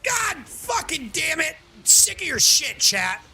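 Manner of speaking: half-joking outburst at the chat; mock furious shout. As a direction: blow up at chat in mock rage.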